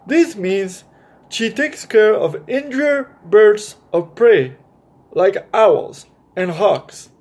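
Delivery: bright, lively storybook read-aloud; animated picture-book narration; reading to children. A narrator's reading. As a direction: read it like story time for kids.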